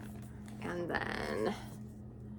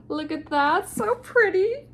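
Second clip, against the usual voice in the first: gushing; 10 semitones higher, 6 dB louder than usual. Real, not acted. Gushing over a book edition.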